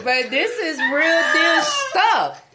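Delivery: slow, half-laughing protest; laughing while talking. Real, not acted. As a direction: insist while cracking up. Insisting over the laughter.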